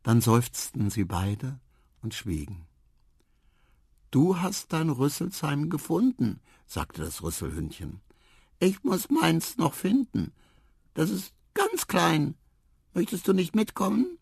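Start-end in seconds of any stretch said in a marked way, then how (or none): 4.1-14.2 high, small creature voice; little dog voice. Acted. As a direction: speak like a tiny shy animal. (hohe kleine Hundestimme)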